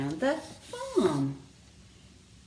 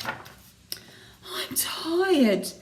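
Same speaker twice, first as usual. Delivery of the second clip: whispered, higher toad voice; tired whisper; 9 semitones higher than usual. A narrator's reading. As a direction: sigh it out in a whisper. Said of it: "said the toad".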